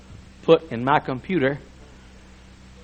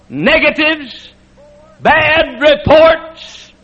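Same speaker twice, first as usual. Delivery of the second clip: high, shouted sermon climax; preaching at full force; 14 semitones higher, 9 dB louder than usual. Acted. Preacher shouting scripture to the congregation, fiery. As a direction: shout it to the rafters like a revival preacher.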